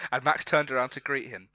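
normal talking voice

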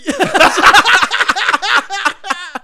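a long, high, loud laughing fit. Real, not acted. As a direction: laugh hard for a while. Long laughing fit over smell.

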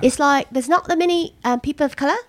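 chatting in a put-on Essex accent.

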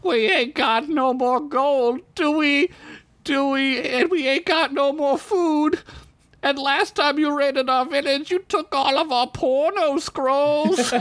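put-on high, old elf begging; elderly elf pleads with the knights; elderly elf pleading. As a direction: beg like a frail old elf. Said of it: "said an elderly elf".

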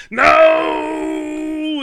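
long, high, drawn-out wail of denial; hammed-up movie-hero scream, held long; mock anguished yell. Retelling Jake learning his parents are missing, mock anguished yell. Retold Jake cries out in despair.

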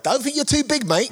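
high-pitched mock British heckler; cheeky high warning. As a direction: pitch it up as a put-down from the crowd. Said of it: "British people are like".

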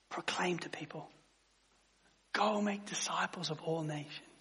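soft, close, pleading near-whisper; intimate hush. Acted. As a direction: lean in and plead gently. Pastor pleads intimately with congregation.

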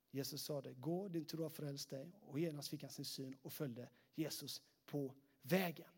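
low, soft, measured Bible reading; scripture reading. A narrator's reading. Pastor reads the healing of the blind man to the congregation, subdued scripture reading. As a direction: read the passage out quietly and evenly.

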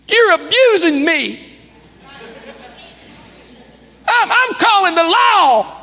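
high, drawn-out imitation of an aggrieved kid; mock child protest. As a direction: play a child wailing at a parent's rule. Imitates child protesting a parent's rule.

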